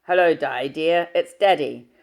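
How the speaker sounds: booming fatherly hello down the line; deep masculine voice. Acted. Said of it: "a deep masculine voice".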